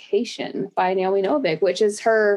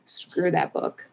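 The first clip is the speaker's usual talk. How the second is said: mock-muttered dismissal; 5 semitones lower, 5 dB quieter, slower than usual. Real, not acted. Jokey dismissal of wizard books.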